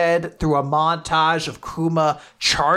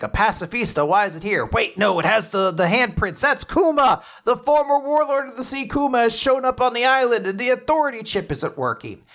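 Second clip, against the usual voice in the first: acted-out panicked report, climbing higher; alarmed Marines; 6 semitones higher, faster than usual. Marines report Kuma landing on the island, alarmed. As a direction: rattle off the alarm in a raised, urgent voice. Marines reacting to Kuma's arrival.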